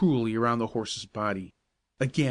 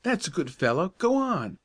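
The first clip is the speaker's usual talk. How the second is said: rising high and pleading; entreating; 6 semitones higher than usual. Acted. Joe begging Old Charlie to walk on: high pleading coax. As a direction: sweet-talk the horse, climbing higher. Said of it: "entreated Joe".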